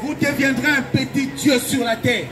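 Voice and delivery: ordinary delivery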